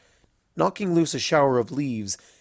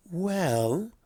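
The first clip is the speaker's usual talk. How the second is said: stretched-out well; drawn out; 6 semitones higher than usual. A narrator's reading. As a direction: draw the word out slowly. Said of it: "drawing the word out".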